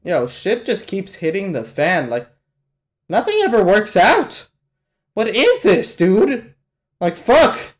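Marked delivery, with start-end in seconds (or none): 3.1-7.7 high, raised-voice frustration; exasperated outburst. Real, not acted. Viewer fed up as nothing works out, exasperated yelling. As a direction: cry out in real exasperation. Exasperated at mounting disasters onscreen.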